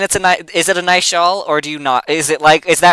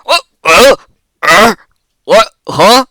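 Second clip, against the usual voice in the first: a lightly voiced goblin, confused and raised; a goblin caught off guard, a touch higher; startled goblin; 3 semitones higher, 5 dB louder than usual. Goblin grabbed by plasmoid, mildly startled. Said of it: "and he says".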